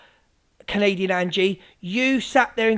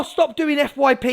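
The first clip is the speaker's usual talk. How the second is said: normal talking voice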